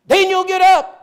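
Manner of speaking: very high, loud yell; yelling for husband. Retold or imitated. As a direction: shout across the house to wake him. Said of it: "she starts yelling across".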